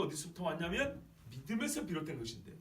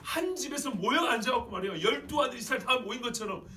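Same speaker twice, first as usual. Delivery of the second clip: full-force preaching; 8 semitones higher, 7 dB louder than usual. Real, not acted. Preacher picturing the family reunited, full-force preaching. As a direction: preach it out with big emphasis.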